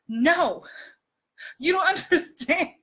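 high, overacted plea breaking into laughter; mock emoting. Shown as a demonstration. Coach mocking her own old acting, hammed-up emoting. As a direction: overact the emotion, then crack up. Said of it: "I was emoting".

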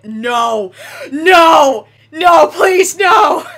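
loud, high, desperate cries of no; panicked screaming. Real, not acted. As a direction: scream it like you're about to be hit. Panicking at a train in game.

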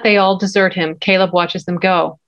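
normal talking voice

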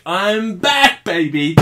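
rising, punchy hype; triumphant comeback. Acted. Vlogger announcing his return, rising and triumphant. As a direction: announce it to the lads with a grin.

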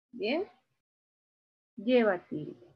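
ordinary talk

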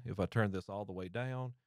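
quiet, uncompressed mic sound; compressor off. Shown as a demonstration. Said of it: "turn that completely off".

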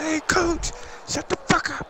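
high mocking voice mouthing off to coach; squeaky mock back-talk at the coach; player mouthing off. Player mocks his coach in a squeaky high voice. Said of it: "He said".

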